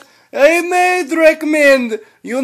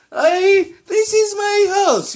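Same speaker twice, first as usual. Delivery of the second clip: excited shout; 9 semitones higher than usual. Acted. Proud outburst about his house.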